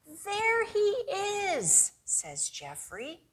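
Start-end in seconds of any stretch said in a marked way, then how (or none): 0.1-1.9 much higher, slow joyful cry; a kid spotting his lost fish; excited boy. Acted.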